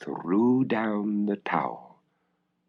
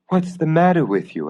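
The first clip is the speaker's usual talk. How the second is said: concerned father; 8 semitones higher than usual. Acted. Father to sobbing daughter.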